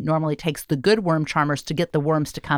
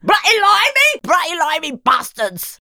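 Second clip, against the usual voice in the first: loud, much higher hammed-up English accent; mock English accent; 10 semitones higher, 4 dB louder than usual. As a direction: spit out the insult in a bad English accent. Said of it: "how good is your English accent?".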